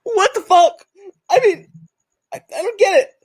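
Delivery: Stacey stunned by the videos; Stacey reenactment. Acted. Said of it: "[name] will be playing the role of Stacey".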